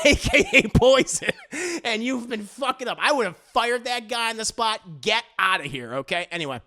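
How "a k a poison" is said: laughing through the joke. Real, not acted. Cracking up at the poison joke, real laugh. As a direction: giggle it out.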